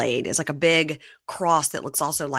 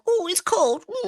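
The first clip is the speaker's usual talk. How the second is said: very high, pouty imitation whine; hammed-up pouty complaint; mock pouting; slower than usual. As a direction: imitate a sulky pout about the cold. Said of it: "She was pouting a lot".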